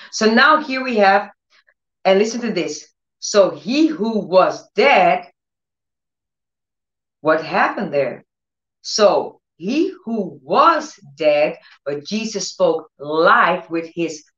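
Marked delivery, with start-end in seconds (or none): 3.2-5.3 drawn out slowly, each word weighted; slow emphatic. Acted. Preacher slowing for the key words. 8.8-11.6 even slower, stretching each word; slower emphatic. Acted. Preacher repeating the miracle line slowly.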